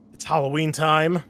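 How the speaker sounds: loud, high announcer hype; booming host intro; hyped announcement. Acted. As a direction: sell the spooky season. Host hyping Halloween episode.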